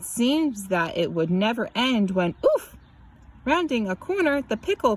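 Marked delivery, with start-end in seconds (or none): 2.4-2.7 high sharp oof; a sudden winded grunt; oof of impact. A narrator's reading. The pickle grunts as it slams into the boy.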